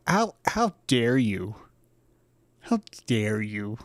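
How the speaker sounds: slow, affronted dog voice; hammed-up indignation, taken slowly; offended dog. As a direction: draw out each word in mock offence. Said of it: "the dog is offended".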